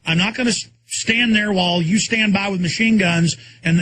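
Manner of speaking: ordinary delivery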